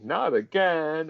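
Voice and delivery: high, drawn-out mock cry; retold tearful wail, stretched out; Twice crying. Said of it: "as he cries".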